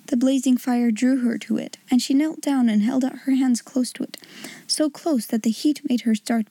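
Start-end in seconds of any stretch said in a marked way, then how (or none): none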